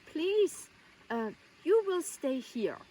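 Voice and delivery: put-on high plea with a German accent; Jacqueline. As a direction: imitate a German-accented hostess insisting. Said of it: "with this very heavy accent".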